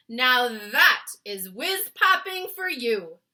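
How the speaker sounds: loud, slow proud giant. A narrator's reading. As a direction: boast it loudly. BFG giant voice.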